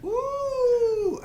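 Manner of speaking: loud, high-pitched woo; high whoop. Real, not acted. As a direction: let out a long cheering whoop. Cheering the movie mention.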